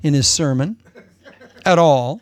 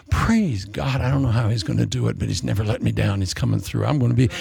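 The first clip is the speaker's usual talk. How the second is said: quiet trust; 6 semitones lower, 3 dB quieter than usual. Pretend. Voicing a faithful believer.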